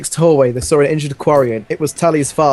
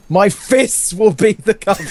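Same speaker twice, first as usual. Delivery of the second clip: laughing boast; 7 semitones higher than usual. Acted. Garfield's boast breaking into laughter.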